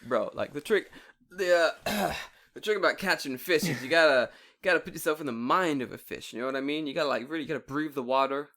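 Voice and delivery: loud, hammed-up fishing expert; mock fishing guru holding forth; know-it-all fisherman. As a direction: give bogus advice with pretend authority. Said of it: "like, a professional fisherman".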